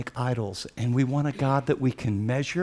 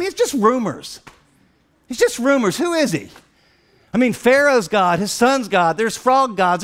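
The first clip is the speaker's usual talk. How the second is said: higher-pitched, driving preacher delivery; impassioned preaching; 7 semitones higher, 4 dB louder than usual. Real, not acted. Preacher retells Israel meeting the invisible God, impassioned preaching. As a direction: preach it with rising urgency.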